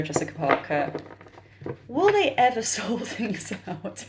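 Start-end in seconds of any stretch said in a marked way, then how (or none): 1.9-4.0 slow question broken by a real laugh. Real, not acted. Reader asking about Kim and Sarah Jessica, laughing. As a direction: giggle through the question despite yourself. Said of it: "I don't know why I'm laughing".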